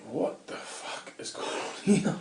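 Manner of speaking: slow, whispered disbelief; stunned whisper. Retold or imitated. Man retelling his arrest to a listener, in a stunned whisper. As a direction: whisper it slowly, as a baffled inner thought.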